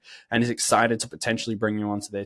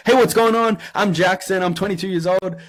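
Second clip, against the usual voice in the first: much higher and louder, bursting with hype; really high energy; 8 semitones higher, 6 dB louder than usual. Shown as a demonstration. Applicant pitching himself on Loom, loud high-energy hype. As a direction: bounce in like you're thrilled to be here. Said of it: "if you talk really high energy".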